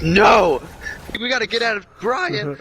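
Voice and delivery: panicked shout. Retold or imitated. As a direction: yell it like you're fleeing. Panicked friend voiced in story.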